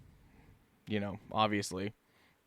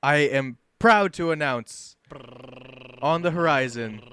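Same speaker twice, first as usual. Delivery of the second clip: louder ringmaster build-up; milk the drum roll like a circus announcer; showman announcement; 4 dB louder than usual. Pretend. Host building suspense before the big reveal, ringmaster style. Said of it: "I'm really milking this one".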